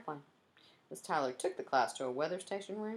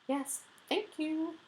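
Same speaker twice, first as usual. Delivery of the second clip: high, drawn-out polite brush-off; slow sing-song dismissal; cutting someone off; much slower than usual. A narrator's reading. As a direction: stretch it out high to stop the speaker. Guide cuts Cam short.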